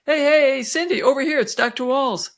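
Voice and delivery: high, loud attention-getting call. Retold or imitated. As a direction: hail someone across a waiting room. Waving to get nurse's attention.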